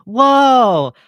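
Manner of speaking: loud, high, falling whoa; big hammed-up astonishment; exaggerated amazement. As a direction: belt out a theatrical whoa. Mock amazement at opponent.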